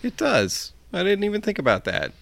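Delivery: high and fast, caught out; excited. Real, not acted. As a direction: blurt it out quickly, amused. GM laughing at own oversight.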